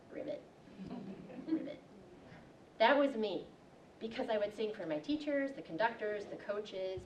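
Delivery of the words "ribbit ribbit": a flat, deadpan ribbit croak; frog croak. Retold or imitated. The frog on Broadway only croaks, deadpan. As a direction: croak it dully, the magic gone. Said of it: "and the frog goes".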